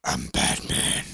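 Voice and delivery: low, slow rasp; hushed hero growl; Batman. Acted. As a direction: growl it in a hoarse superhero whisper. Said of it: "you have to say it like".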